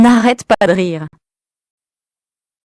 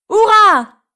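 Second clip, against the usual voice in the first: cheer; 13 semitones higher than usual. Acted. Zoé cheers as she wins the sled race, high. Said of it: "crie Zoé".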